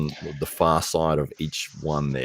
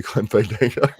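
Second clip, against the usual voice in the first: laughing; 7 semitones higher, 8 dB louder than usual. Real, not acted. Laughing through own joke.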